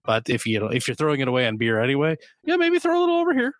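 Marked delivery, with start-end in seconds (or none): 2.5-3.5 high, quick put-on little voice; jokey high-pitched wheedle, rattled off fast; mock coaxing. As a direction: squeak out a playful pitch for spare cash. Jokingly pleading for listeners' spare money.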